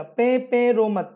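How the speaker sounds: stretched out slowly, word by word; slow mnemonic drill. Shown as a demonstration. Teacher drilling a memory trick for students, slow and deliberate. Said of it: "कैसे याद रखोगे".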